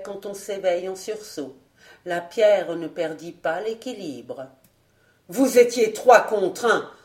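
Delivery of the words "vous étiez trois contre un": loud, reproachful accusation; furious master berating his servants; growing anger. A narrator's reading. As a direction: scold them, anger rising. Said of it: "dont la colère allait croissant".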